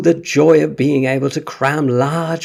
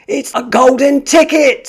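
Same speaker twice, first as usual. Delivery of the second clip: high, loud thrilled scream; excited scream; 10 dB louder than usual. Acted. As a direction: scream it out in sheer disbelief. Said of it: "screamed the shopkeeper".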